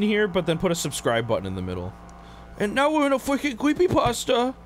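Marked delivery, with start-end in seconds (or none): 2.6-4.5 surprised. Real, not acted. Creepy area appears.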